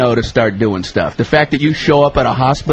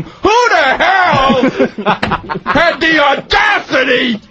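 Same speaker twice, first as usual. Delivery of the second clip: furious femur patient; 11 semitones higher than usual. Retold or imitated. Angry femur patient barging in.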